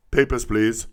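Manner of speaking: loud mock German-accented official. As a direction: put on a stern German accent like a checkpoint guard. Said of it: "I know that's a German accent. I can't do the Russian".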